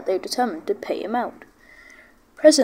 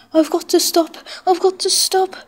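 high, strained, desperate cry; a panicked plea to stop; groaned in panic; 9 semitones higher, 4 dB louder than usual. A narrator's reading. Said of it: "groaned James".

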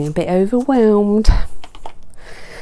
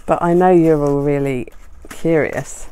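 ordinary talk

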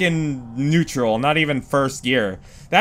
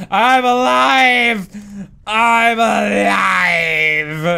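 drawn-out joyful yell, the second one stretched even more; triumphant cry; 4 semitones higher, 4 dB louder, much slower than usual. Real, not acted. Gamer celebrating escape from the monster, drawn-out yell. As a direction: cry out that you survived.

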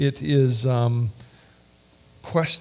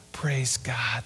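soft whispered praise; 3 semitones lower, 6 dB quieter than usual. Real, not acted. Preacher praising God after the psalm, whisper. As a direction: breathe it out reverently.

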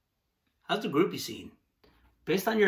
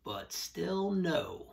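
very slow, quiet refusal; dragged-out no; 5 semitones higher, 6 dB quieter, much slower than usual. Real, not acted. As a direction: stretch it out, deadpan. Deadpan rejection.

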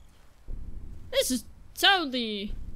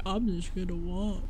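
unhurried game character; 5 semitones lower, 7 dB quieter than usual. Retold or imitated. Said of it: "Why is my character like".

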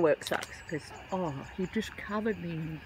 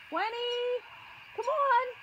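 much higher, drawn-out call to the bird; slower than usual. Acted. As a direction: sing the name out to call her over. Calling bird by name.

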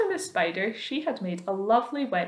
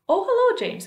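much higher and louder, sweet friendly greeting; cheery glowworm; 7 dB louder than usual. A narrator's reading. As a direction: greet him brightly, surprised.

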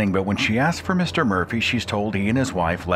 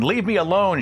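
screaming; 11 semitones higher than usual. A narrator's reading. Terrified babysitter screaming into the phone. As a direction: scream it at the caller. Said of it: "she screamed".

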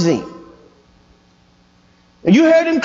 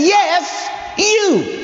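higher, declarative divine answer, slowing down; voice of God; 4 semitones higher, much slower than usual. Acted. God choosing a person for a task, proclaiming. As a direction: proclaim the choice slowly and firmly in a raised pitch. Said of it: "God, through Christ ... is saying".

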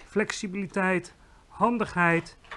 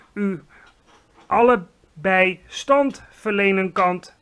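big booming giant voice, much louder and higher; friendly giant; 3 semitones higher, 8 dB louder, slower than usual. Acted. Giant bows and hails the Queen in booming praise. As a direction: boom out grand praise like a clumsy giant.

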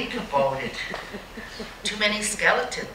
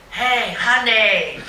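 slow teasing "Hey, honey" with a laugh; flirty call, laughing; 3 semitones higher, 5 dB louder, slower than usual. Retold or imitated. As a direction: call out to a passing guy, half laughing. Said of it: "one of them would say".

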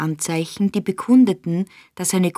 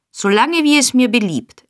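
higher, louder defiant comeback; defiant retort; 6 semitones higher, 5 dB louder than usual. A narrator's reading. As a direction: talk back stubbornly to the wife. Husband defies scolding wife. (trotzig, laut)

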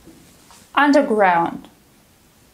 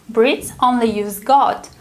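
usual speaking voice

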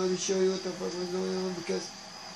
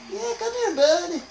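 much higher, mocking come here buddy; taunting call; 10 semitones higher, 5 dB louder than usual. Real, not acted. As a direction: lure him in with a sing-song taunt. Taunting enemy before knifing.

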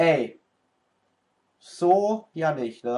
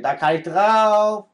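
louder and higher, repeating the demand; annoyed repeat at the game; insistent repeat; 6 semitones higher, 5 dB louder than usual. Real, not acted. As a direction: say it again, louder, as if ignored. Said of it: "Ey, ich sagte".

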